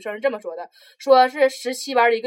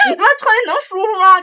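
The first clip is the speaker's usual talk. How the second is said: higher, louder laughing punchline; laughing mock rant; 11 semitones higher, 8 dB louder than usual. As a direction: burst out laughing at the teacher's rant. Drunk teacher's rant, voiced. (笑着模仿老师的醉话)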